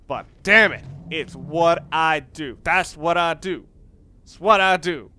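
slow down and punch each word; mock exasperation. Real, not acted. Player owning up to needless fussiness, emphatically.

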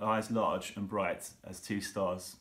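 usual speaking voice

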